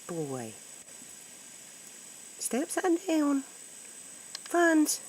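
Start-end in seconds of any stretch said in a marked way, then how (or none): 2.4-5.0 very high coaxing pet talk; high-pitched pet call. Real, not acted. Calling to the pet cat.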